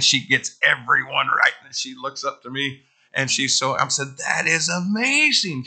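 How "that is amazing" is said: drawn-out, high-pitched gushing amazement; slow, rising praise as if to a child; amazed praise. Retold or imitated. Preacher retelling how he praised a little reader, amazed praise.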